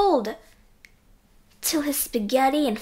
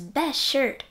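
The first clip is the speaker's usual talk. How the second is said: ordinary delivery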